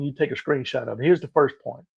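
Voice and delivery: usual speaking voice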